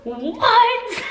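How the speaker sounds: high, drawn-out astonished question; amazed disbelief. Retold or imitated. Whole banquet hall celebrating her birthday, amazed. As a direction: squeal it, breaking into a laugh.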